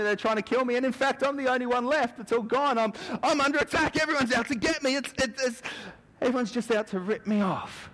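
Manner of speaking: very high, frantic complaint; panicky Elijah. Retold or imitated. Elijah answering God that everyone hunts him, frantic high wail. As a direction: wail that everyone is after you.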